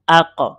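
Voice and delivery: "আক" with a short echo after the q; qalqalah bounce. Shown as a demonstration. Teacher showing learners the push, "আক" with a bounced q. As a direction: push off the final q of "আক" so it rebounds. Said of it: "এই যে আমি একটু ধাক্কা দিলাম".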